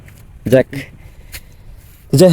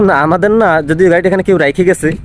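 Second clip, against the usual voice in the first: usual speaking voice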